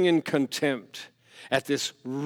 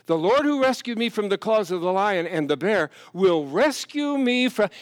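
louder, higher preaching cry; fervent quote; 6 semitones higher, 6 dB louder than usual. Retold or imitated. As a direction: proclaim it with fire. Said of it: "he said".

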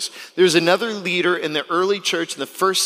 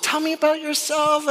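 mock polite interviewer; 12 semitones higher than usual. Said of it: "It wasn't".

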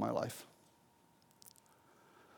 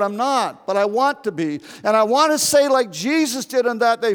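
louder, higher pleading sermon voice; impassioned preaching; 7 semitones higher, 5 dB louder than usual. Acted. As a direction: build the appeal at full preaching force. Preaching climax, pleading with congregation.

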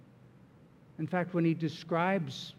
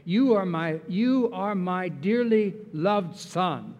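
slow and louder, savoring each word of love; fervent reading; 5 dB louder, slower than usual. Acted. Pastor reading the Father's words, slow and louder. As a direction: read it like a blessing.

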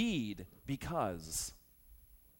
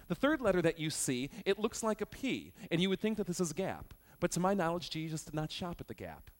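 ordinary talk, nothing marked

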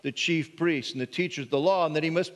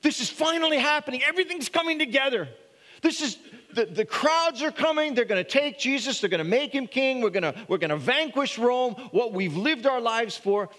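much higher, fast and eager; excited disciples; 7 semitones higher than usual. Retold or imitated. Disciples picturing Jesus made king, excited and high. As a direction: voice the disciples' rising hopes, bursting with excitement. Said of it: "they're thinking".

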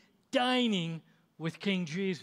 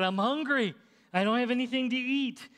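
higher-pitched retold plea; pleading excuse; 7 semitones higher, 3 dB louder than usual. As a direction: imitate a hungry man explaining himself, pitch raised. Caught passenger pleads his hunger.

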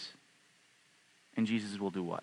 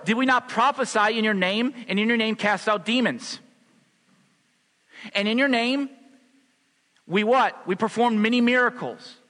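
loud, high, fervent preaching; full-force sermon; 8 semitones higher, 5 dB louder than usual. Acted. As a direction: preach it out with fire to the church.

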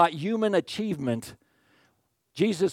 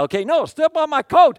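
eager fan; 12 semitones higher, 10 dB louder than usual. Retold or imitated. Fan offering coat.

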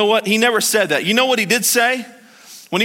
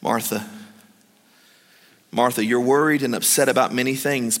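gentle Jesus; 5 semitones lower, 3 dB quieter than usual. Retold or imitated.

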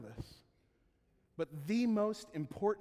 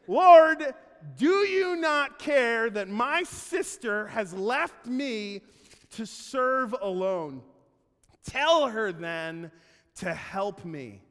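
loud, slow, aggrieved plea; a put-upon sister demanding help; indignant complaint; 6 semitones higher, 13 dB louder, much slower than usual. Retold or imitated. Martha complaining to the Lord.

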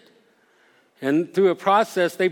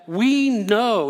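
"We know" pitched up, firmly stressed; emphatic; 5 semitones higher than usual. Acted. Pharisees answering the blind man, emphatic. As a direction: lean hard on "We know". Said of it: "it's emphatic".

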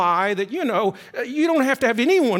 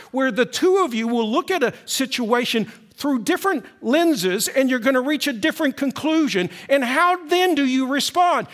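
rising, louder preaching push; 5 semitones higher, 4 dB louder than usual. Acted. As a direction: lift it to the congregation with building energy. Pastor preaching to congregation.

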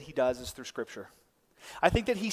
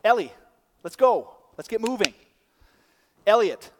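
raised, higher, insistent calling; parent losing patience; 5 semitones higher, 9 dB louder than usual. Acted. Dad calling daughter who keeps doing her thing, impatiently. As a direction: call the child sharply, louder now. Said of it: "your parent's just yelling at you".

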